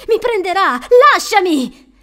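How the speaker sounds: high, loud frightened shout; child's panicked scream of words; panicked boy yelling. A narrator's reading. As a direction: yell in terror, fighting to break free. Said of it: "urlò Joby".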